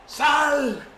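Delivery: loud, high commanding cry; shouting exorcism. Acted. Disciples shouting at the unclean spirit, loud. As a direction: yell the spirit out with force. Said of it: "uno gritaba más que otro".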